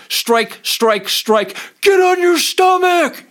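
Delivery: a retold cop roaring at a suspect on the ground; barked police command. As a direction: yell it like a cop mid-arrest. Cop beating, shouting commands.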